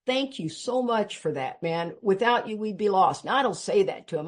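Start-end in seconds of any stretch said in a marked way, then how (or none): none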